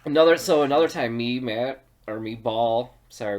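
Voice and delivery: usual speaking voice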